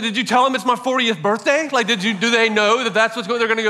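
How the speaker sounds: a touch of hurt frustration, pressing the point; frustrated disbelief. Retold or imitated. Retelling how he pushed back when friends were skipping his fortieth, mildly frustrated. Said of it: "getting kinda frustrated".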